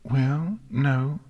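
Nix, troubled. Acted. Filly confessing guilt over a dream, troubled.

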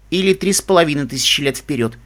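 usual speaking voice

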